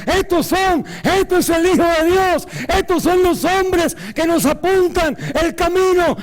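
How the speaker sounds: very high, loud cry of the demons. Retold or imitated. Preacher voicing the demons crying after the apostles, high and loud. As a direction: shriek it like the possessed girl. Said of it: "decían".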